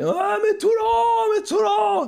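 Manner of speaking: high, slow mock wail; mock shout. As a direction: cry out Toulon in mock despair. Teasing Toulon fans, mock lament.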